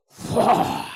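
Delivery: a sudden loud whoosh of fire; whooshing fire noise. Acted. Preacher makes fire crash down with a whoosh. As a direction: blast out the sound of fire falling.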